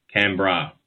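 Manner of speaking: Canberra as "CAN-bra", two syllables; Australian pronunciation of Canberra. Shown as a demonstration. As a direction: end Canberra like the word "bra". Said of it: "like what a woman wears".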